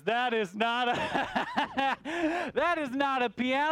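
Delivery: breaking into a laugh mid-punchline, slowed down; cracking up at your own joke; laughing through the line. Acted. The host laughing as the moth delivers the punchline.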